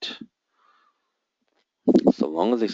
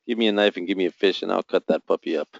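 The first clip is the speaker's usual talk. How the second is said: usual speaking voice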